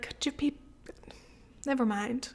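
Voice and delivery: meek trailing off. Pretend. Giving up on shushing the couple, meek and soft. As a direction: lose your nerve mid-ask. Timid backing down.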